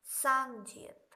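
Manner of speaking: teacher stressing सांग जियत slowly; stressed doubled j. Shown as a demonstration. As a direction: press the sound at सांग जियत. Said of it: "हम पढ़ रहे हैं".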